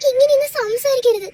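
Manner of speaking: crying, begging him to stop; pleading through tears; tearful plea. Acted. Wife begs her husband in tears not to say such things.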